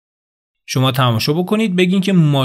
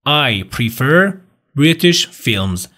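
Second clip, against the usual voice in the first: "I prefer British films" very slowly, rise on "prefer"; drawn-out model for repetition; slow model answer; much slower than usual. Shown as a demonstration. Teacher modelling the British answer for the class. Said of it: "شما بیاین بگین".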